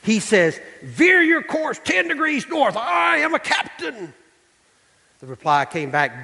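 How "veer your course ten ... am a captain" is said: high, slow, commanding captain's order; hammed-up indignant captain; irate captain. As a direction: lay down the law as the ship's captain. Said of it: "The captain was irate".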